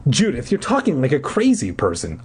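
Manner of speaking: usual speaking voice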